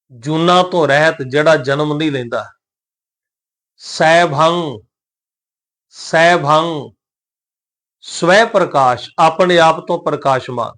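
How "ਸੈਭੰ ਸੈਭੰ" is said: ਸੈਭੰ very slow, sai-bhang with nasal close; careful pronunciation. Shown as a demonstration. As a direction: hold both syllables of ਸੈਭੰ, no hard final g. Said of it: "ਸੈਭੰ ਸ਼ਬਦ ਦਾ ਉਚਾਰਨ". (ਸੈਭੰ ਨੂੰ ਹੌਲੀ, ਨਾਸਕੀ ਅੰਤ ਨਾਲ)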